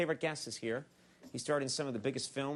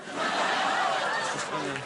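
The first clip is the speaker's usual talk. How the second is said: laughing; 5 dB louder, much slower than usual. Real, not acted. Laughing at own joke.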